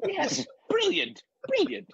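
very high, drawn-out mock approval; delighted client. Plays client approving the pitch.

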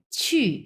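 ছি as "chü", starting high, falling sharply; fourth-tone demo. Shown as a demonstration. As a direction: drop ছি steeply with a rounded ü. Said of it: "এরকম উচ্চারণ হবে".

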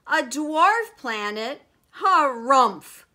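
loud, high and slow disbelief; indignant yell. A narrator's reading. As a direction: spell out the insult loudly, then harrumph. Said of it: "he yelled".

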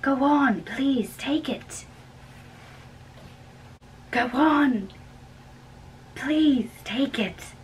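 coaxing insistence. Acted. Pressing money on the nurse.

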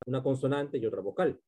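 normal talking voice

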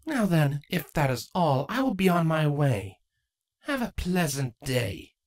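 nonchalant. Acted. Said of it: "Draco nonchalantly said".

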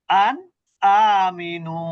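আন আমিনু with the ন of আন pronounced clearly, drawn out slowly; clear nun recitation. Shown as a demonstration. Teacher slowly demonstrating clear nun recitation to a student. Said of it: "নুনটাকে স্পষ্ট করে পড়তো".

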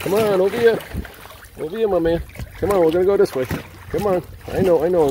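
high, loud encouraging call; urging the dog on, pitched high and loud; high coaxing call. Real, not acted. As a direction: coax him over, bright and up high.

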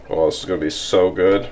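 normal talking voice

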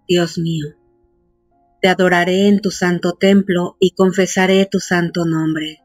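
ordinary delivery

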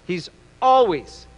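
"always" drawn out slowly; stress on always. Real, not acted. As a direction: lean on "always" and stretch it out. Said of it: "let me say it again".

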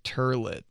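"turlet" with an r in the first syllable; a parent-style botched "turlet"; mispronounced toilet. Shown as a demonstration. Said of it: "instead of toilet".

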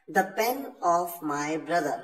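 very slow, words spaced; slow dictation. Shown as a demonstration. Teacher reading the example very slowly. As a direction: read out the alternative slowly. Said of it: "इस तरह से भी आप बोल सकते हैं".

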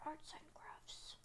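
slow whispered words; whispering. Pretend. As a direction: whisper it out slowly. Whispered repeat.

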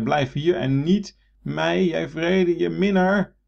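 high, loud, slow anguished plea; Narcissus calling out. Shown as a demonstration. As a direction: cry out to the fleeing reflection. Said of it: "Wat moet je niet doen?".

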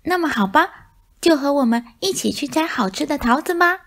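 high, lightly voiced curious mice; chirpy little mouse question. Mice invite the cat. (小老鼠好奇地问，声音尖细)

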